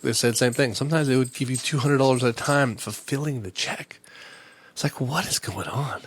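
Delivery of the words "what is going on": ordinary talk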